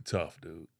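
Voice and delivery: low, soft, gruff approval; tough playground guy. Retold or imitated. Playground opponent to the newcomer, low grudging respect. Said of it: "they'll look at you and they'll say".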